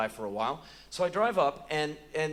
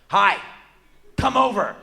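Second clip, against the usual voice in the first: put-on loud, high child's demand; blunt, shouted kid's invitation; demanding little kid; 5 semitones higher, 10 dB louder than usual. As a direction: yell it down the phone like a kid.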